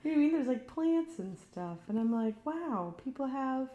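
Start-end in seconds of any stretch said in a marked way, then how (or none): 2.5-3.7 mock wonder.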